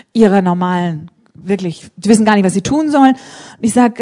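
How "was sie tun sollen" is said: usual speaking voice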